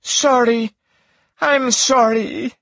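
high, drawn-out tearful apology. A narrator's reading. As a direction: plead forgiveness in a high, tearful character voice. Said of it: "with tears in her eyes".

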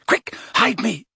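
high, urgent plea; panicked. A narrator's reading. Boy wants to hide behind his friend, panicky. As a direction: blurt it out in alarm. Ron panics at seeing girls.

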